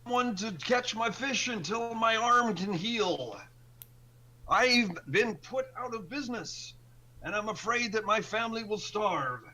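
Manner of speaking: a hint of a desperate old fisherman; worried old fisherman.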